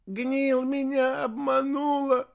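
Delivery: high, drawn-out tearful wail; sobbing. A narrator's reading. Heir blaming the one who deceived him, sobbing. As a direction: wail it like a wronged child. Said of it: "всхлипнул носатый".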